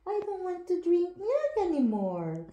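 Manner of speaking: put-on high little baby voice, laughing. Speaking for the baby who won't drink milk, high and giggly. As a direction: pretend the baby is refusing milk.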